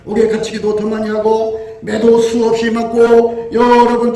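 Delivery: loud, steady preaching recital of Paul's trials; scripture recital. Acted. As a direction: declaim the list with rising force. (바울의 고난을 힘차게 낭송하는 설교)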